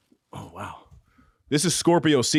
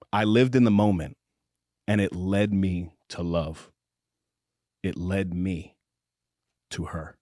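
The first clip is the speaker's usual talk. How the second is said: slow, low romance narration; audiobook narration; 6 semitones lower, 3 dB quieter than usual. Acted. Host reading a spicy romance passage aloud, measured narration. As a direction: read it like a slow, intimate audiobook.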